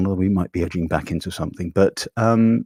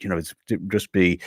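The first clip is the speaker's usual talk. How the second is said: ordinary talk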